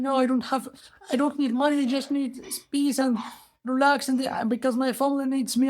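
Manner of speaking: high, halting plea; acted earnest, high-voiced protest; poor man pleading. Poor man declines the money.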